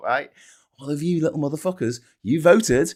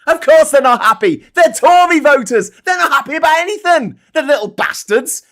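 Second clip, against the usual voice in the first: louder, higher angry yelling; furious shout; 10 semitones higher, 11 dB louder than usual. Real, not acted. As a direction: bellow it with real anger. Furious rant about Tory voters.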